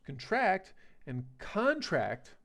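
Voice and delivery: "contract and contract" said slowly, stress shifting between syllables; slow contrast of the two stresses in "contract". Shown as a demonstration. Teacher to students drawing out both stresses slowly. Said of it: "two subtly different things".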